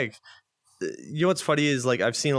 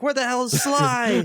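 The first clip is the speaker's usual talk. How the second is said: high, loud and slowed, voicing the crowd's panic; a hammed-up alarmed yell from the crowd; mock panicked shout; 12 semitones higher, 4 dB louder than usual. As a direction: cry out as if the whole crowd lost Sly. Crowd freaking out, retold.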